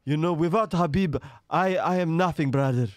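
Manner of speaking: high, loud Dagestani-accented impression; Islam Makhachev. Fighter praising his mentor in an interview, loud accented impression. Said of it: "Should I do him an Islam Makhachev?".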